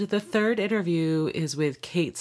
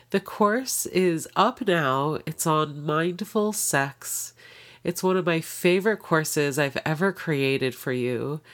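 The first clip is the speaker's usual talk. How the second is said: usual speaking voice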